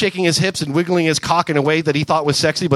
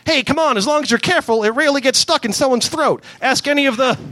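higher wheedling raccoon; Rocket voice; 8 semitones higher than usual. Acted. Rocket coaxing Gamora, higher wheedling voice. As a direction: coax her, cheekily.

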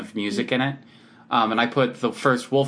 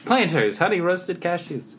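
higher, punchy brand slogan; hard sell ad; 6 semitones higher than usual. Acted. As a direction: announce the product name. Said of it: "It's a commercial if you just repeat it".

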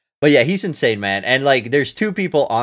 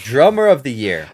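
loud, raised announcer-like call; announcing a category; 4 semitones higher, 9 dB louder than usual. Real, not acted. Moving on to the next award category, announced loudly. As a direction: call out the award title, drawn high.

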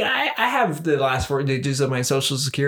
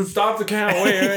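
mock panicked yell; 6 semitones higher than usual. Mocking panicked politicians.

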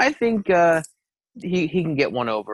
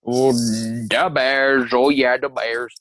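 slow, drawn-out Chicago "da Bears"; Chicago accent; much slower than usual. Acted. Confirming his pick to the cohost, slow, drawn-out Chicago accent. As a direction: stretch out "the Bears" like a Chicago sports fan. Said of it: "a bad Chicago accent".

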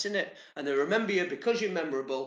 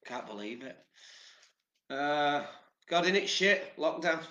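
usual speaking voice

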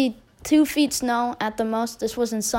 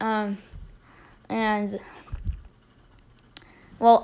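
ordinary talk

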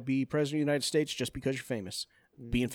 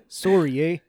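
mock Canadian accent, louder; 6 dB louder than usual. Joking after cohost went Canadian, mock Canadian accent. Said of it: "He went Canadian right there".

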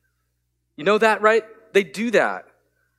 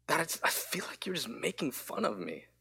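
whispered, hurt words of a small daughter; 14 dB quieter than usual. Retold or imitated. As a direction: breathe it out like a wounded child. Daughter says she feels mocked.

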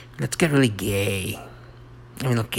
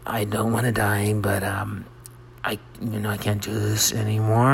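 normal talking voice

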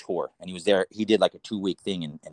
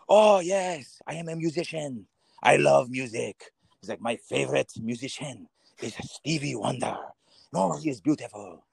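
put-on lightly French-accented, higher and eager; a hint of Yusef gushing in a French accent; 3 semitones higher than usual. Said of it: "This guy's name's Yusef".

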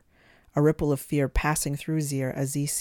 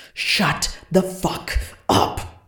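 slow, loud, strained scream; 4 semitones higher, 8 dB louder, much slower than usual. A narrator's reading. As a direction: scream it to hurt the ears. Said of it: "Shalot screamed".

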